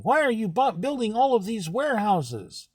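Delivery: mock scream. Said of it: "People were screaming".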